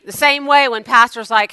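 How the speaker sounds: ordinary talk, nothing marked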